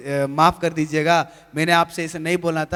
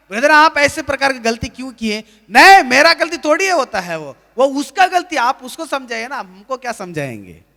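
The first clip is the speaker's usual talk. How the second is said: preaching; 5 semitones higher, 7 dB louder than usual. Acted. Acting a brother's excuse.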